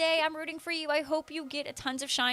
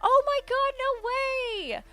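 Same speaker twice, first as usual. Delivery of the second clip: squealing with delight; high thrilled scream of joy; shrieking jackpot winner; 12 semitones higher, 6 dB louder, slower than usual. Real, not acted. Viewer announces going to Go Fest.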